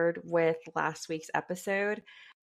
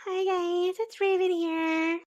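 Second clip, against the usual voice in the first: high, chipper imitation; customer service voice; 12 semitones higher than usual. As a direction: mimic a perky phone rep. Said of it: "what was that voice?".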